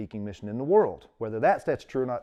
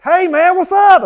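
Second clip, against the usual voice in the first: very high, loud hillbilly hello; hillbilly greeting; 19 dB louder, slower than usual. Retold or imitated. Greeting a Campbell County friend, loud hillbilly holler. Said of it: "you sound different when you talk to Kevin".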